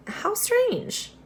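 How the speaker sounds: high, puzzled wonder. Acted. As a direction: sound surprised and curious. Voice puzzled in story.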